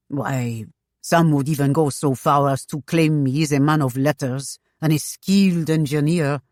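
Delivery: put-on French-accented gentleman, dismissive; testy French husband. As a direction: discredit a rival in a French accent. Jean's French accent in story.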